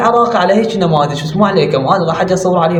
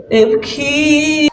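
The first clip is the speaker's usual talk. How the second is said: ابكي drawn out very slowly and much higher; mock crying girl; 8 semitones higher, much slower than usual. Mocking the girl who films herself crying, high whiny mock wail. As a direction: whine ابكي like a fake crier.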